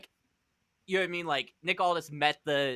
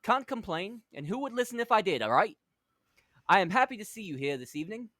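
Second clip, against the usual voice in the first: put-on higher-pitched British accent, genteel and welcoming; 3 semitones higher, faster than usual. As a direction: play a courtly English villain, overly polite. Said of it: "You don't have to do the accent".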